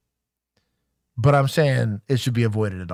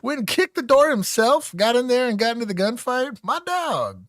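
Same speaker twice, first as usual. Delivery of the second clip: hyped; 9 semitones higher than usual. Real, not acted. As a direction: cheer him on in disbelief. Hyped praise for Big Sarge.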